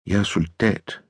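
soft, hushed woman's reply; quiet insistence. A narrator's reading. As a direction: restate it softly. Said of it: "gentager hun".

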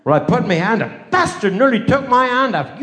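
usual speaking voice

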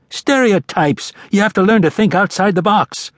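high-pitched, exasperated retort; indignant scoff. A narrator's reading. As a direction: scold him for the cliché in a high voice. Bearded man scoffs at Shadow's question.

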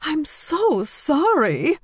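very high, slow, swooping; exclaiming apology. A narrator's reading. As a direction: gush a tipsy apology in dismay. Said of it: "Jane exclaimed loudly".

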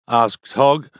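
plain reading voice

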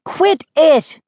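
high-pitched pestered protest; whined. A narrator's reading. Sister fed up with commentary, whining. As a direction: whine for it to stop. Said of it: "Mindy whined".

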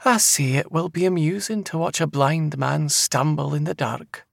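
high Scottish seer voice; amused seer. A narrator's reading. Seer mocking the departing man, high and amused. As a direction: taunt him high, with amusement. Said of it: "She laughed".

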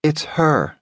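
cold. Acted. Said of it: "saying coldly".

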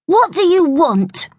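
high-pitched, grumpy challenge; scowling. A narrator's reading. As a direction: demand it with a scowl. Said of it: "scowled Bertie".

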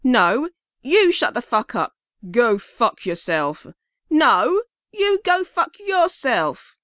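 shouting match. Acted. Drunk prisoners trade shouted insults.

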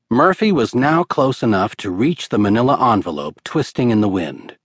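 ordinary delivery, nothing marked

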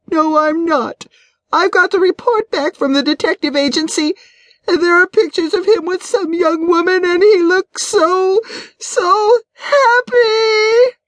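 high, tearful voice slowing into a wail; starting to cry. A narrator's reading. Wife tells her friend about the detective's pictures, breaking into crying. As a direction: hold out the last words as the tears come. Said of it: "Janet began to cry".

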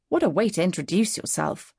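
ordinary delivery, nothing marked

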